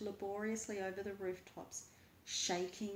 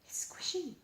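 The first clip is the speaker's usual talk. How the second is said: higher, hushed wonder; awed whisper; 9 semitones higher than usual. A narrator's reading. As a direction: breathe it with disgust. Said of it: "Margaret pokes it".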